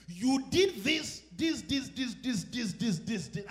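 slow punched "this, this", high and loud; mock lawyerly listing. Husband listing his wife's faults point by point, loud and slow. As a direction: tick off each point like a prosecutor.

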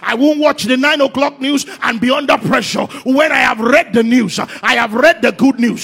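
loud, high, sung-out preaching climax; shouting preacher in full flow; full-force preaching. Acted. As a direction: preach it to the rafters, half singing. Preaching at full force on God's record.

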